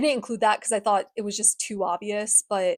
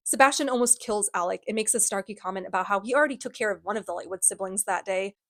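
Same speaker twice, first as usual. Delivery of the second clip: normal talking voice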